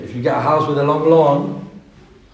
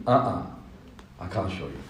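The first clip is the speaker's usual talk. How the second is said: much softer and lower; soft refusal; 8 semitones lower, 13 dB quieter than usual. Acted. Big fish quietly refusing the small fish, soft. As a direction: gently decline.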